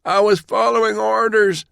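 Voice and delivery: very high, anguished cry; desperate high-pitched protest; agonized plea. A narrator's reading. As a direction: plead through searing pain. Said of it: "his scorched face contorted in agony".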